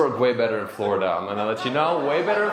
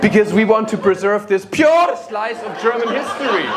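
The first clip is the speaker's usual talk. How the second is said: loud, higher hammed-up German accent; German reenactor; 7 semitones higher, 8 dB louder than usual. German reenactor defending the uniform, high hammed-up German accent. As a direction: protest grandly in a mock German accent.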